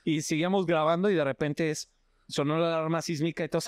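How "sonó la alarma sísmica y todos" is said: ordinary delivery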